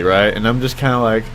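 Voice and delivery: ordinary delivery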